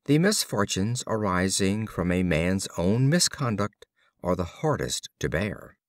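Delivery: usual speaking voice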